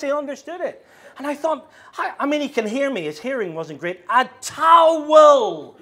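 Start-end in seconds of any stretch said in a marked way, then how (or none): 4.1-5.7 "towel" in two drawn-out loud syllables, laughing; louder two syllables, laughing. Retold or imitated. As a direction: shout "A towel" slowly, cracking up. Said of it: "pronounced it with two separate, distinct syllables".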